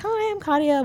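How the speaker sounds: bright greeting. Retold or imitated. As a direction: greet the friends sweetly. Greeting his friends.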